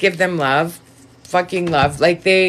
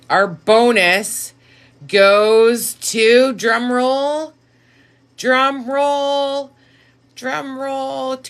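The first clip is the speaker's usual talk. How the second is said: suspense build-up; 8 semitones higher, much slower than usual. Real, not acted.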